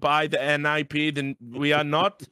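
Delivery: stilted mock lecture; hammed-up humourless official; imitating ESL producer. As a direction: explain with laboured, pedantic care. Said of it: "But he kept going".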